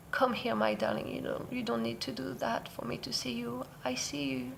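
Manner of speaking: mother gently comforting her daughter; loving and nurturing. Retold or imitated. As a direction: soothe her like a darling child. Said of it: "really loving and nurturing".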